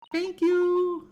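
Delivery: high, bright-eyed "Thank you!" as Gina; high gushing gratitude. Acted. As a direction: blurt it out overjoyed. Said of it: "Say it".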